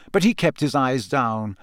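ordinary delivery, nothing marked

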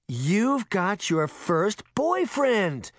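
high, drawn-out gleeful squeal of teasing; delighted exclamation. A narrator's reading. Mother delighted at daughter's first boyfriend, exclaiming happily. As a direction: draw it out high with happy excitement. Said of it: "Veronica exclaimed, clapping her hands happily".

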